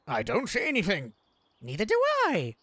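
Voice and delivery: game teacher. Acted. Voices game teachers searching.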